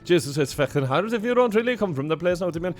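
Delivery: high, loud, rushed thick Irish; thick Irish accent. Shown as a demonstration. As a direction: rattle it off in broad West Cork Irish. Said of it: "as thick Irish as you can get".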